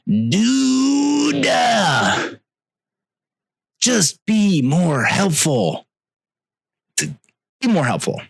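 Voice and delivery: high frustrated yell easing into a slow plea; exasperated plea. Real, not acted. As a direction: beg them to just be helpful. Exasperated rant at airline.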